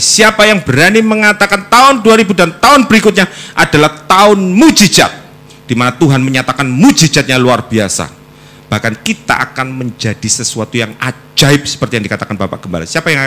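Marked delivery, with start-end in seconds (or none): 0.0-8.1 high, loud challenge to the crowd; fiery preacher's challenge; preaching at full force. Real, not acted. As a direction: rouse the congregation, loud and high. Preacher challenges congregation loudly.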